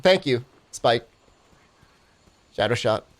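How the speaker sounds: ordinary talk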